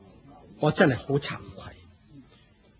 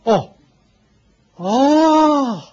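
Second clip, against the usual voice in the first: a startled, rising oh; surprised oh; 10 semitones higher, 6 dB louder than usual. Acted. Fire cult leader startled as Buddha appears, surprised. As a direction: caught off guard, voice jumping up.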